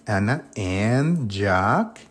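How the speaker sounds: Ana and Jack drawn out very slowly; very slow model phrase. Shown as a demonstration. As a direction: stretch each word for learners. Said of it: "El and".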